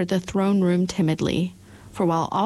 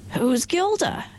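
curious question; 11 semitones higher, slower than usual. A narrator's reading. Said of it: "inquired the Scarecrow".

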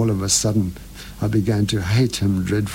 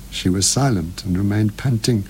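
plain reading voice

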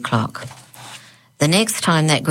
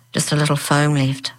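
plain reading voice

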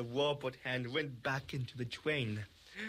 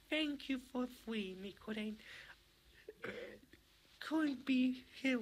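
high soft Poppy voice; 8 semitones higher, 4 dB quieter than usual. Acted. Poppy speaking.

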